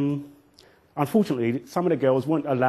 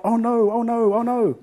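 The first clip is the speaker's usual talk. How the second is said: crowd dismay; 10 semitones higher, 6 dB louder than usual. Retold or imitated. Crowd reacts to the father.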